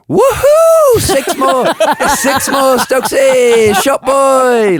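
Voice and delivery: high, loud whooping cheer; hammed-up cheering from the crease; cheering shout. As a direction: whoop it up to wind up the bowler. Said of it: "Like, out loud".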